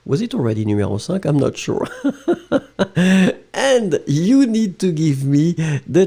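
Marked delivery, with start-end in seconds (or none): 1.9-3.5 light laugh. Real, not acted. Laughs at own confusion.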